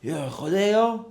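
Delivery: high, drawn-out hail; calling out. Retold or imitated. Stranger calls the storyteller over, high stretched-out call. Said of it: "er ruft mich".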